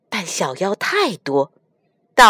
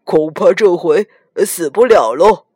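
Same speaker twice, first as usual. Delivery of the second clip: smug; 5 dB louder than usual. Acted. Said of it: "猪八戒得意地说".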